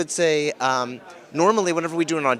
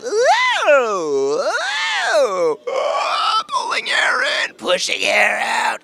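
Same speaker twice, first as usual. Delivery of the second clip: loud rising ooh sirens, then slow pushed breaths; warm-up noises; 10 semitones higher, 4 dB louder, much slower than usual. Shown as a demonstration. Voice actor demoing his car warm-up noises, loud and slow. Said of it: "making weird noises".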